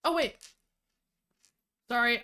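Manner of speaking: usual speaking voice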